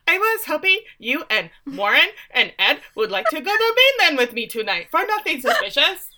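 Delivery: Erin impression. Asking the Flynns to come to the mainland, hammed-up innocence. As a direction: act casual and fail, badly hiding an ulterior plan. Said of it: "That's exactly how she says it".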